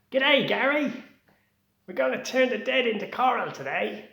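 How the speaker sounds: very high, loud cheery Australian greeting; a worker greets Gary at the coral company, Australian accent. Acted. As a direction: beam it out like a happy Aussie. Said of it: "I'm just an Aussie".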